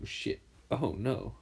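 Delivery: usual speaking voice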